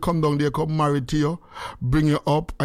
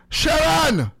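calling out; 11 semitones higher than usual. Real, not acted. Calling out to Sharon.

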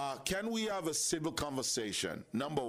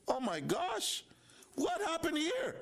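high, stunned gasp of disbelief; shocked; 13 semitones higher than usual. Pretend. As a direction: act out the shock of a rude reply. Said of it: "And you say to yourself".

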